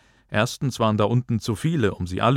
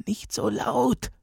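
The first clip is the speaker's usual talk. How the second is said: high, soft, drawn-out squeak; 12 semitones higher, 4 dB quieter, slower than usual. A narrator's reading. Teacher begging Booker to keep quiet, squeaking. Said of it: "piepste der Lehrer".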